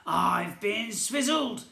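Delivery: slow, loud, high goblin wail; goblin yell. A narrator's reading. Goblin prince complaining of being cheated, slow loud yell. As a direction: drag it out in outrage. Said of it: "Prince Gobby yelled".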